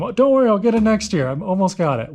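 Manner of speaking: called out high and loud; yelling across. Retold or imitated. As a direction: yell it over the engine noise. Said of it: "every year I yell to him".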